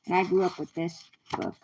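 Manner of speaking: ordinary delivery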